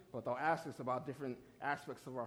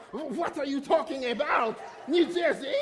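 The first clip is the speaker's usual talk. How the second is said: high, loud mock-outraged teacher with French accent; incredulous shout; 11 semitones higher, 10 dB louder than usual. French teacher reacting to a wrong answer, incredulous shout.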